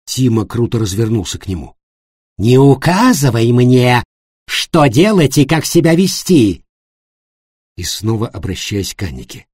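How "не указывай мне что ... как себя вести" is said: loud, slow angry rebuff. A narrator's reading. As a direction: bark it back at him.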